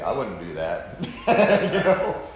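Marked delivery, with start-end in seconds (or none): 0.0-1.0 a hint of a stern parent, lower; lightly voiced disapproving parent; parent's warning. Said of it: "They can hear me say".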